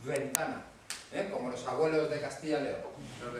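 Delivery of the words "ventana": VENTANA with a fricative V. Shown as a demonstration. Teacher showing the class an old Castilian sound, fricative V in Ventana. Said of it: "dice la V fricativa".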